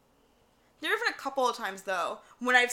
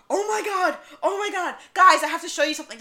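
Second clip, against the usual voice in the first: high, loud, fast "oh my god"; excited freak-out; 7 semitones higher, 9 dB louder than usual. Retold or imitated. Zach spotting an EMF spike, loud and fast. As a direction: gush like he found a ghost. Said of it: "And Zach goes".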